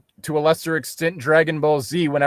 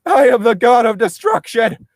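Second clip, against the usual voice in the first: loud, raised mock boast; hammy shout; 8 semitones higher, 6 dB louder than usual. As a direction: declare it like an overblown anime god. Joining the hammy heroine bit.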